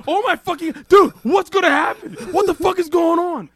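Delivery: high, loud, panicky wail; mock panic. Retelling his own panic to the friend, high and loud. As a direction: freak out like you just took mystery pills.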